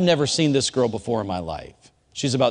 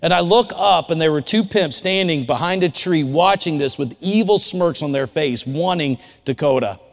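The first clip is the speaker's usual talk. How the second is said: preaching at full force; 6 semitones higher, 4 dB louder than usual. Acted. Sermon narration at full force.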